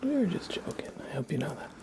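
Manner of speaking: soft unvoiced whisper, gentle; calm him down softly, then greet; reassuring whisper. Real, not acted. Streamer reassuring Clyde it was a joke, whispering.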